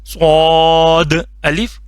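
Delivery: صاد said thick and heavy, slow and stretched; thick saad. Shown as a demonstration. Teacher showing students a heavy صاد, slow. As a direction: make صاد full and heavy, not like سین. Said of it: "سو موٹا کر کے".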